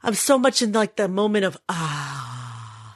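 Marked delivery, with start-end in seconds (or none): 1.7-2.9 long, low, soft relieved sigh; blissful sigh. Retold or imitated. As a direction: sigh out low and let it trail. Acts out moment of enjoying quiet.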